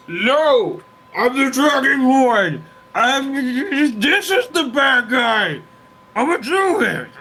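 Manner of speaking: high strained shout through teeth; through clenched teeth. Acted. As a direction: force it out through gritted teeth. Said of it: "between clenched teeth".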